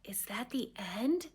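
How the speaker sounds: breathy unvoiced whisper, slowed down. Drowsy boy whispering to his mother at bedtime, hushed whisper. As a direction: hush it like a drowsy child at bedtime. Sleepy child asks at story end.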